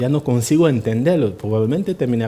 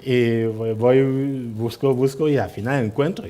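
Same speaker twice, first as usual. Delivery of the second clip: ordinary talk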